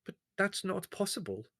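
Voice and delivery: high, nervous protest; flustered, backing away. Acted. The owner, nervous before Leslie: high nervous protest. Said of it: "said the turkey owner nervously".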